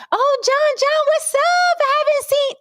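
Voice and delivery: high gushing female greeting; excited woman's voice. Retold or imitated. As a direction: play the gushing woman running up. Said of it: "I heard a voice like".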